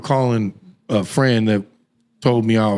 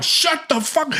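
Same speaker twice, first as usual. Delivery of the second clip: very high-pitched exasperated self-talk; mock self-scolding; slower than usual. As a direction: scold yourself in a high voice, half giggly. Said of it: "I'm telling myself".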